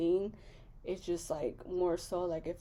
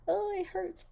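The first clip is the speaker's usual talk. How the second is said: high-pitched hammed-up complaint of pain; mock whining; 12 semitones higher than usual. Pregnant vlogger mimicking someone doubled over with cramps, mock whining. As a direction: play up the agony in a high mock wail.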